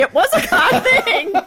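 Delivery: high, loud, laughing through it. Real, not acted. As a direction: laugh the words out. Laughing agreement.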